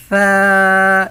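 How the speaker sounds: ثا loud and high, tongue lightly between the teeth, air let out; a loud, light ثا with the tongue tip out; thin Tha. Shown as a demonstration. Teacher shows students the letter ثا, loud with the tongue between the teeth. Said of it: "جیسے میں پڑھ رہا ہوں".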